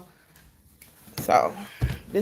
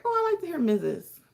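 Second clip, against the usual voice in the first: pleased reaction; 8 semitones higher than usual. Retold or imitated. Quotes own pleased reaction.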